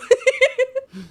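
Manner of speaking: shrieking laugh, very high; high burst of laughter. Real, not acted. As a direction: lose it completely. Laughs at the line.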